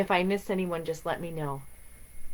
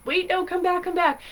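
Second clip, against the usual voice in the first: mock pleading call; 10 semitones higher, 3 dB louder than usual. Calling the snake back.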